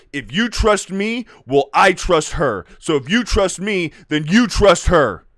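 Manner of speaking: mock-heroic persuasion; Obi-Wan's earnest plea. Mocks Obi-Wan's earnest speech.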